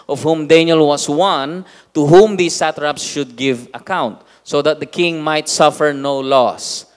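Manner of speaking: loud, slow, solemn Bible reading; scripture reading. Acted. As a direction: proclaim the verses to the church. Reads scripture aloud to congregation. (malakas at mabagal na pagbasa ng Bibliya)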